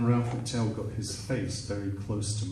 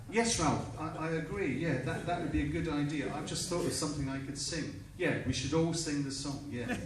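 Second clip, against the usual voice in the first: high, soft, hurried placating; cowed agreement; 5 semitones higher, 10 dB quieter, faster than usual. Retold or imitated. Singer placating Ralph after being yelled at, meek and hurried. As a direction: agree meekly in a small high voice.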